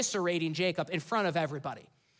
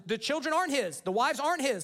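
usual speaking voice

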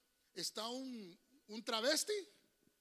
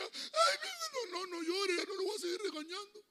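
whiny, much higher imitation of a parent pleading with the crying child; mock doting parent; 10 semitones higher than usual. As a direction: mimic a coddling mother, teary and high. (imitando a una madre consentidora, aguda y llorosa)

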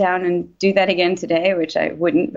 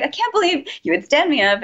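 roommate's complaint retold in a higher pitch; imitated yelling; 9 semitones higher than usual. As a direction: retell a scolding with the pitch jumping up. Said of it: "he kept yelling at me".

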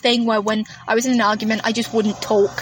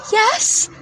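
high questioning; 10 semitones higher than usual. Retold or imitated. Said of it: "she went".

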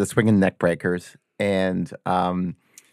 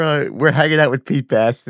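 ordinary talk, nothing marked

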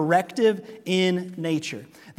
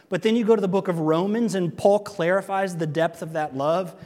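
usual speaking voice

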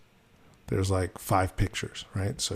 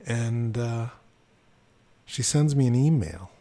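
ordinary delivery

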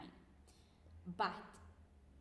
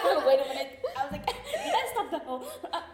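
flustered laughing apology; 3 semitones higher, 8 dB louder, faster than usual. Retold or imitated.

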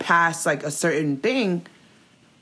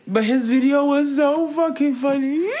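laughing; 7 semitones higher than usual. Real, not acted. Telling co-host about his funny video, laughing. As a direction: pitch climbing into real laughter.

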